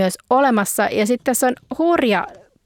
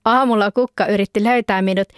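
usual speaking voice

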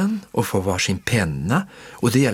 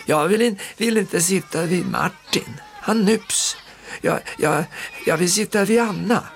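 put-on high, whiny child voice; complaining little girl; 8 semitones higher than usual. A narrator's reading. Girl complaining to her aunt, high and whiny. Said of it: "Lotta går till faster och klagar".

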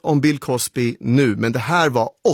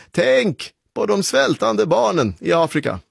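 mildly scolding, pitched up; a parent's earnest reproach, higher; admonishing parent; 8 semitones higher than usual. Acted. Parent to child who won't finish dinner, admonishing.

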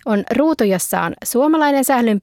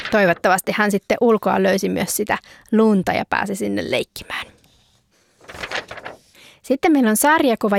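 ordinary talk, nothing marked

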